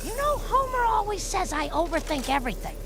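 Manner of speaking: ordinary delivery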